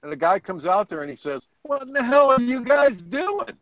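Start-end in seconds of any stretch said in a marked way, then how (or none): 1.6-3.6 high-pitched retold question, mock bewilderment; baffled onlooker. Onlooker confronting sailplane pilots at the field, mock bewildered. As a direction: imitate an incredulous passerby, pitched well up.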